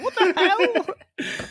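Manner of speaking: slow, high, laughing disbelief; incredulous what the hell. Retold or imitated. Cohost reacting to the flirting scene, slow laughing disbelief. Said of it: "I'm like".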